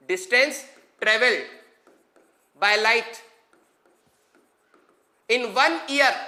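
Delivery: long pauses between words; word by word dictation. Shown as a demonstration. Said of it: "बहुत धीरे धीरे बोल रहा हूं". (एक एक शब्द रुक कर)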